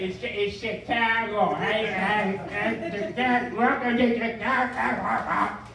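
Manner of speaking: exaggerated, chewed-up Chicago accent. Mocking meat packing people, in gnawed Chicago accent. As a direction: gnaw on every word like a Chicagoan. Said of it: "we physically gnaw on the language with our accent".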